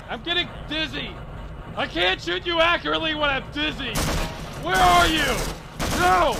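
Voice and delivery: high, loud frantic shouting; panicked, indignant yelling; yelling under fire. Acted. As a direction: shout over gunfire to the attackers. Yelling to attackers amid gunfire.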